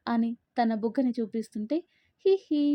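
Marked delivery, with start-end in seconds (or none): 2.3-2.6 high, louder acted "hee hee"; playful mock giggle, pitched up; toothy giggle. A narrator's reading. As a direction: giggle cheekily through a wide grin. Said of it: "పళ్ళు బయటపెట్టి నవ్వుతూ".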